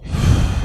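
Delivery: forceful breathy sigh out; sharp open-mouthed exhale; demonstrated exhale. Shown as a demonstration. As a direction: push a voiceless breath out through the mouth. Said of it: "exhaling out our mouth".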